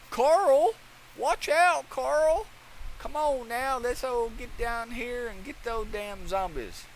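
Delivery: high, loud Southern holler easing into a drawn-out call; heavy Southern accent. Acted. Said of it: "heavy southern accent".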